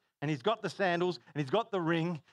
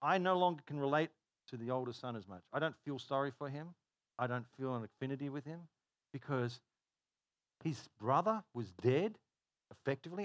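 ordinary talk

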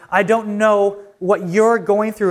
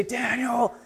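higher, soft imitation of wife calling; drawn-out name, pitched up but hushed; retold call; 4 semitones higher, 7 dB quieter than usual. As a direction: softly mimic a faint call from upstairs. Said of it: "I hear her say".

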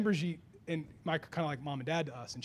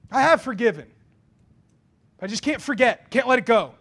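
loud, emphatic excuse; defensive insistence; 3 semitones higher, 10 dB louder than usual. Acted. Believer excusing a grudge, loudly defensive. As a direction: insist on it, raising the volume.

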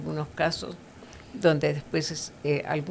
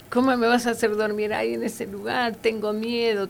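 put-on higher, pleading little boy's voice; a hint of a scared child; 5 semitones higher than usual. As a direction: play the frightened son begging his father. Frightened child pleading with father.

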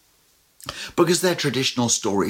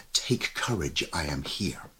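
softer throwaway version; 10 semitones lower, 10 dB quieter than usual. Shown as a demonstration. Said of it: "He doesn't write".

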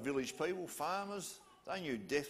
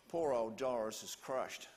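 usual speaking voice

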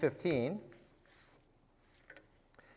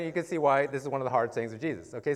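ordinary delivery

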